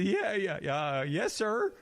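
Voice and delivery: hurried sheepish yes sir, up high; nervous yes sir. Retold or imitated. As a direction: caught out, scrambling to agree. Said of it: "I said".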